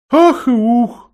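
slow, high, mournful hoot; slow doleful owl moan; sad owl sigh. A narrator's reading. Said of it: "печально произнесла сова".

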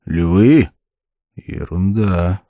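loud incredulous question, then slow dismissal; scornful snort. A narrator's reading. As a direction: scoff at the very idea, drawing out the dismissal. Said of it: "фыркнул Конан".

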